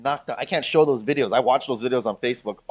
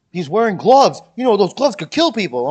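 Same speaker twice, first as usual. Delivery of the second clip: loud, higher mock of a defendant's excuse; lightly put-on voice of an aggrieved man; mock courtroom testimony; 7 semitones higher, 13 dB louder than usual. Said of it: "he was like".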